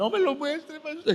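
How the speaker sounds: high-pitched mock tearful plea; imitated crying. Sister begging pastor not to show it, tearful imitation. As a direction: plead like a weeping woman. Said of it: "Ella lloraba".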